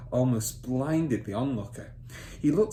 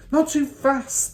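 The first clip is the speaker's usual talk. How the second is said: much higher, louder, slow plea; anxious son; 9 dB louder, slower than usual. Acted. Son asking father to slow dictation, high and slow. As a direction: beg him to slow down.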